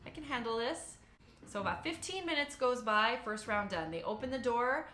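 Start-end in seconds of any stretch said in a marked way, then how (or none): 0.1-1.0 high nervous self-pep. Retold or imitated. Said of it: "I'm like".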